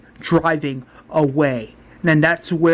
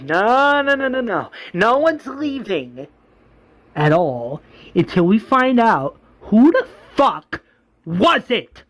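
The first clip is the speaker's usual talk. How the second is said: menacing refusal; 6 semitones higher than usual. Retold or imitated. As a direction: lay down the law as the governor, voice pitched up. Governor forbids anyone to leave.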